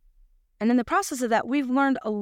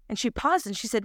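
ordinary delivery

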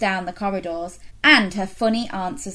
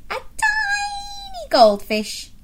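very high, slow squeak; tiny squeaky voice; slower than usual. Acted. Listing pets in voices.

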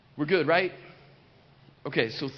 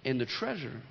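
quiet, dropping; 6 semitones lower, 7 dB quieter than usual. Real, not acted. Preacher to congregation, hushed and tender. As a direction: lower and softer with every repeat. Preacher builds to the point softly.

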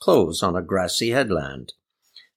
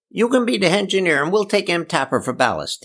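higher dialect voice with a grin; grinning; 9 semitones higher than usual. A narrator's reading. Eli handing out crew jobs, high and grinning. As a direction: say it high, teasing through a grin. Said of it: "he grinned".

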